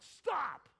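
loud commanding halt; scoutmaster's shout. Retold or imitated. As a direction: bark it to freeze the troop. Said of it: "he had his arms out".

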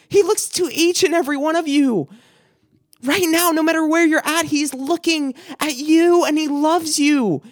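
climbing, fervent altar-call preaching; preaching at full force. Acted. Preacher telling the congregation God looks at them, fervent.

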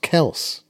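Kels as one syllable, no EE ending; pronunciation shown. Shown as a demonstration. Co-host passing on a player's own surname pronunciation, one-syllable Kels. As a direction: say Kels to rhyme with bells. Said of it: "his second name's pronounced Kels".

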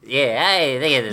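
slow, squeaky henchman voice; Minion impression. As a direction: drag it out in a tiny voice. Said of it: "he has a much higher voice now".

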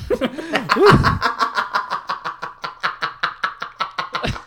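long, high real laughter; loud, breathless cracking up; helpless laughing fit. Real, not acted. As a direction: laugh until dizzy, needing a breath. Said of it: "dizzy from how much I laughed".

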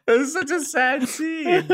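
mock-sad words lost in high laughter; laughing. Real, not acted. Cohost mock-mourning Bing Bong, laughing through it. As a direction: fake grief drowned by giggles.

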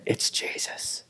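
breathy dramatic whisper. Acted. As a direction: let the reveal drop to a hush. Revealing Jesus in a hush.

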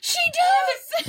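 high, loud delighted shout; squealing with recognition; excited agreement. Real, not acted. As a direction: burst out in sudden delight.

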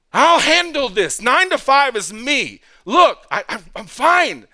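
loud, high, slow insistence; insistent self-reliance. Acted. Believer telling God he can handle weekdays, insistent self-reliance. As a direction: wave off help with emphatic confidence.

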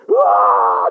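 high, loud unvoiced yell into the wind; storm shout. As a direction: cry out against the storm. Holding tent in storm.

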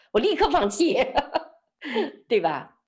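high-pitched comic giving up; mock instant surrender. Speaker joking about quitting when called crazy, mock surrender. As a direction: throw up your hands in jest, voice jumping high.

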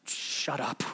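low, very soft mock hush; hushed shut-up. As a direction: silence them under your breath. Moses hushing the panicked crowd.

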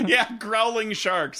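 slow, amused, laughing delivery. Real, not acted. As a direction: savor it through a laugh. Laughing at growling sharks.

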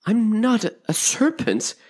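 put-on high, indignant little girl; stumbling, indignant denial. A narrator's reading. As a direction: protest with offended disbelief. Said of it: "said Alice indignantly".

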